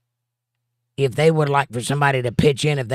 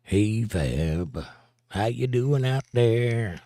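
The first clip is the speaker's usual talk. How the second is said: put-on greeting voice; much slower than usual. Host hailing a chat regular, in a slow put-on voice. Joking echo of greeting to Fab.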